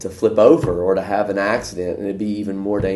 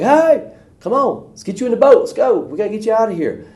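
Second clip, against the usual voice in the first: much higher, louder calling voice in a put-on tone; boat rescuer; 13 semitones higher, 5 dB louder than usual. Man in a boat coaxing the stubborn man aboard, high put-on call.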